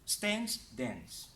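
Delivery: American flat "a" as in cat in "Stance, dance"; American pronunciation. Shown as a demonstration. Teacher offering a choice of accents, American pronunciation. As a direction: say "stance, dance" the American way, short front vowel. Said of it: "British versus American is your choice".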